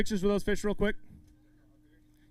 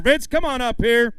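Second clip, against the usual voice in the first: loud, higher stage summons; calling out; 5 semitones higher, 6 dB louder than usual. Real, not acted. Emcee calling the next co-angler up to weigh, calling out. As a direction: call them up over the crowd.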